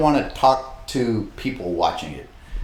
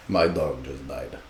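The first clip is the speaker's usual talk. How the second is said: low, slow deadpan; emotionless Russian; 9 semitones lower, 8 dB quieter, slower than usual. Acted. Man imitating emotionless Russians to his friend, deadpan. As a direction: deliver it low and toneless, no feeling at all. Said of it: "Russians don't feel about anything".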